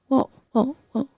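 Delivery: high, softish repeated stammer; flustered mother. Retold or imitated. Acting mother caught out in lie.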